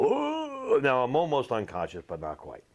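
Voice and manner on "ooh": high crowd-style ooh; imitating the stands groaning ooh; a stadium crowd's shocked ooh. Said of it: "you could hear the stand go".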